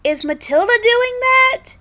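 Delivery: high, loud astonished question; wide-eyed surprise. Acted. Chuck staring at flying pigs, high surprised question. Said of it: "eyes wide".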